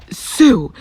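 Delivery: a sharp reproachful cry of a name; scolding. Acted. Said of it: "Ida scolded".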